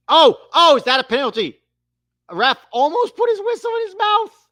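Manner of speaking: real excited yelp of a fan watching live; excited outburst. Real, not acted. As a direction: shoot up in pitch as the foul happens. Near penalty, live commentary.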